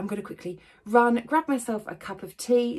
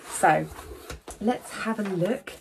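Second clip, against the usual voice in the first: ordinary talk, nothing marked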